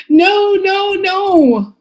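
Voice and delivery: high, drawn-out no, no, no; insistent child's refusal. Acted. Child refusing bedtime from parent, high insistent protest. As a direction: beat out each no in a high voice. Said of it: "You beat the word like a drum".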